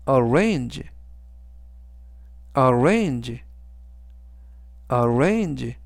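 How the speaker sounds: "arrange" with the stress on RANGE, not the first A; stress on second A. Shown as a demonstration. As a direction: model "arrange" carefully, leaning on the second syllable. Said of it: "맨 앞에 있는 A가 아니라". ("arrange"의 맨 앞이 아닌 중간 A에 강세)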